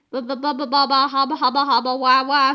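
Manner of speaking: put-on low, dazed baby babble; babbling nonsense. A narrator's reading. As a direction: stammer helplessly like a stunned man.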